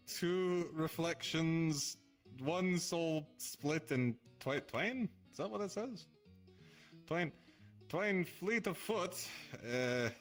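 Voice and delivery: slow, measured riddle reading; slow verse reading. Acted. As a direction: read it like an ominous prophecy. Reads cryptic riddle verse aloud.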